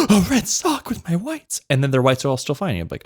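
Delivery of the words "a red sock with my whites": mock horror.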